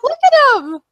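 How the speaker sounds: high, loud squeal; gushing. Retold or imitated. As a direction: squeal over a cute photo. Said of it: "I'm like".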